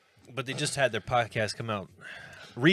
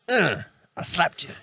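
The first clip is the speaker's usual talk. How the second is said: hammed-up movie slap voice; mock theatrical grunt at a slap; 4 semitones higher, 5 dB louder than usual. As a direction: overplay the slap like a bad actor. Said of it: "theatrical way of being like".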